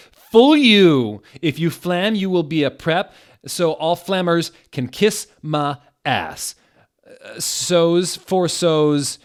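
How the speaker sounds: hammed-up angry author's note; mock rant. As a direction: yell at the haters.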